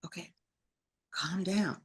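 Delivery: very soft self-soothing; calm yourself, barely voiced; brought down low. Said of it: "I got it down to".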